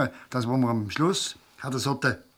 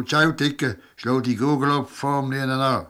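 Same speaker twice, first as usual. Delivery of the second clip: loud parting shout; calling after; 2 semitones higher, 6 dB louder than usual. Acted. Knusti calls a cheeky goodbye after the departing policeman with a loud parting shout. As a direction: call after him as he walks off. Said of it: "rief dann noch".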